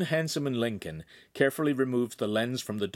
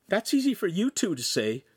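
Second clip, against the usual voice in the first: pouting; 10 semitones higher than usual. A narrator's reading.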